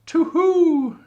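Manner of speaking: high, loud hooting owl call. Acted. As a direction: hoot like an owl up in the tree. Owl character hoots.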